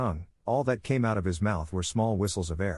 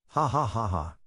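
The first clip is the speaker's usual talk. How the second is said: mock laugh. Mocking laugh.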